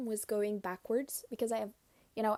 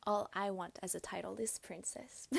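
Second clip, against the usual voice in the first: ordinary delivery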